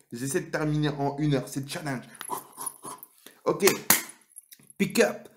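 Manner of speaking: ordinary talk, nothing marked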